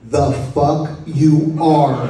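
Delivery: slow, deliberate mock retort, laughing through it; slow punchline retort. Retelling John's line to the mourners, slow and laughing. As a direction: drag the punchline out, each word spaced.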